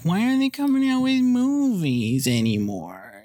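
high, whiny complaining voice; mocking whiner. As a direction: whine it like a spoiled fan. Mocking whiny remake critics.

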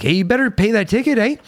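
a lightly voiced Canadian caller; mock Canadian accent. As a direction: mimic a Canadian cop ending on "eh".

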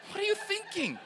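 very high, incredulous squeal with a laugh; mock exasperation. Youth leader to a risk-taking kid, high exasperated mock scolding. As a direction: scold in comic high-pitched disbelief.

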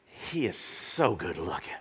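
low, slow, dreamy sigh with a laugh; swooning daughter. Retold or imitated. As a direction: swoon over the star, laughing. Daughter swooning over singer.

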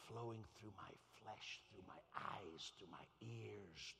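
slow, low, half-whispered preaching; breath-filled, intimate awe; hushed reverence. Real, not acted. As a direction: draw each phrase out low and soft. Hushed reverent preaching about the Spirit.

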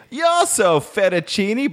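high, slowed hammed-up Italian accent; mock Italian man. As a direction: croon it like a stage Italian. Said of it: "he said".

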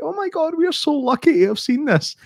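high, thrilled mock tourist voice; gushing tourists. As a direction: squeal like a lucky tourist filming bears. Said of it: "They're like".

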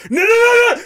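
very high, rapid-fire refusal; mock panicked protest. Geralt refusing to be teleported, mock panicked protest.

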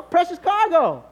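slow, high, loud precious cargo; emphatic drawn out. Retold or imitated. Husband stressing his wife is precious cargo. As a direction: lean hard on precious cargo.

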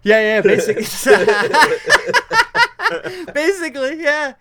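high, loud laughter through the words; laughing. Real, not acted. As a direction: crack up while agreeing. Cracking up at hookah joke.

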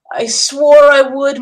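passionate vow. A narrator's reading. Queen answers her beloved.

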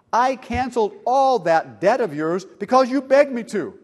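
loud, high-pitched reproach; angry rebuke. A narrator's reading. Master confronts the unmerciful servant, loudly rebuking. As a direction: throw the cancelled debt back at them.

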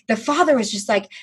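usual speaking voice

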